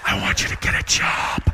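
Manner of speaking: slow whispered demands; nagging whisper. Retold or imitated. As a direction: whisper it like chores. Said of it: "I was waiting for her to be like".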